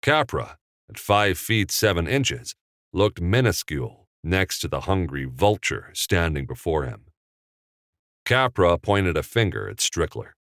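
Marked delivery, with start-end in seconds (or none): none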